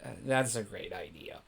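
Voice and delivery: low, soft, resigned agreement; sighing reluctance. A narrator's reading. Luke going along with the medal plan, low resigned sigh. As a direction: give in with a sigh, voice dropped low. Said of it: "Luke kind of sighs and says".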